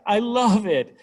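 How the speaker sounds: high, loud gush; acted enthusiastic outcry; mock adoring cry. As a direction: cry out like a devoted fan. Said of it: "I hear you cry".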